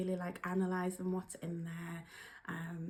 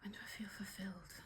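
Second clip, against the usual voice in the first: soft, fast whisper to herself; whispered musing; 12 dB quieter, faster than usual. Real, not acted. Turning the card's question on herself, whispering quickly. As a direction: mull it over under your breath.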